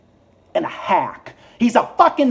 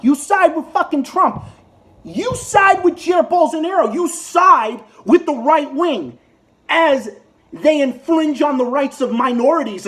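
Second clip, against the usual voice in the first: raised, higher voice, hammering each "side"; angry rant; 6 semitones higher than usual. Real, not acted. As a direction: jab every accusation at him. Ranting at Ted Cruz.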